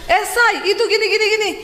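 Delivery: high, urgent chatter; wife's Sulawesi tone. Retold or imitated. Wife talking to husband at waking, high Sulawesi tone. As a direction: rattle it off high-pitched. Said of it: "saya tuh bangun pagi ngomong".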